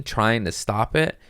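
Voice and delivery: normal talking voice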